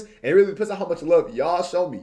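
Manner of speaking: ordinary delivery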